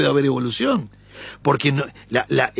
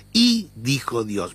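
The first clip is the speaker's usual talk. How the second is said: "Y" stressed and slowed; slower than usual. Shown as a demonstration. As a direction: lean on the "Y", read slowly. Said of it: "Yo voy a darle énfasis en la "y". (la "Y" enfatizada y lenta)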